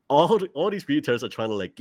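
ordinary talk

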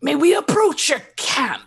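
high, loud, elaborate formal request; hammed-up shaman asking leave, pitched high; lizardfolk shaman. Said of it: "the shaman bows rather elaborately towards Ross and says".

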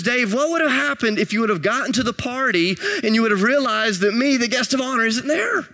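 high-pitched imitation of an indignant wife; mock cross-examination from an upset wife; retold wife's confrontation. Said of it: "some would call it a fight".

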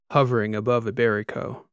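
usual speaking voice

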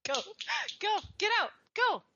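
short, high-pitched commands; shooing. Real, not acted. Host chasing her cat out of the room, shooing. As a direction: shoo the cat with quick high orders.

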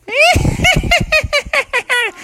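very high witchy cackle; spooky cackle. Acted. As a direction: cackle like a witch outside the window. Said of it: "It sounded like this".

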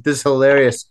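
ordinary talk, nothing marked

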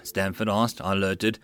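ordinary delivery

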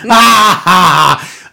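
big drawn-out forced ha-ha; hammed-up laugh. Host capping an absurd rant, mock laughing.

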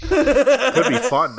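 high, loud, slow laughing take; laughing mockery. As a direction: ham it up through laughter. Mocking the catchphrase.

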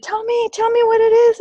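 high, loud, desperate asking; urgent plea. Retold or imitated. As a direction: beg to know, almost crying. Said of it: "I said".

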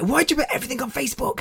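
quick high-pitched mock whinge; mock disgusted sisters. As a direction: mimic the sisters' complaint in a squeezed high voice. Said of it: "much to most of my sisters' disgusts".

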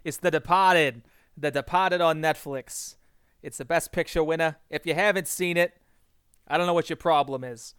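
loud Boston accent, r dropped in "Departed"; brash Boston movie pitch. Shown as a demonstration. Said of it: "that is all I will do of the Boston accent".

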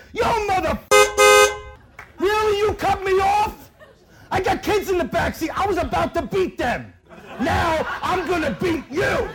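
high, loud mock road-rage shout; hammed-up screaming at the driver who cut her off; furious little woman. Small sweet woman cut off in traffic, mock furious yelling. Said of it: "She's like".

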